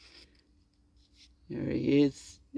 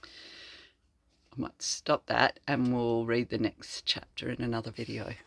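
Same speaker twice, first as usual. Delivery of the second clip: whisper; 7 semitones lower, 8 dB quieter than usual. Real, not acted. Closing the reading, a soft whispered aside. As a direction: breathe it quietly.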